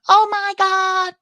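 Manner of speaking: wife thrilled about Sushi Mango, high and slow; excited oh my God. Retold or imitated. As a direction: imitate a fan losing it. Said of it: "So she was all excited".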